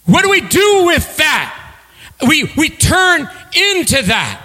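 high, ringing preacher's voice; fervent sermon climax; preaching at full force. Acted. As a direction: proclaim it to the room. Preaching celebration.

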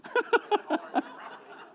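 airy high giggle at his own story; high breathy laugh. Real, not acted. Pastor laughing at his own story, high and breathless. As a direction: laugh silently, breath only.

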